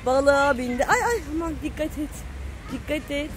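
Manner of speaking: ordinary talk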